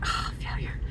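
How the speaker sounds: mock-dejected whisper to oneself; deflated aside. Mom recalling her failed sign language test, deflated whisper. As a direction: whisper it as a small admission of defeat.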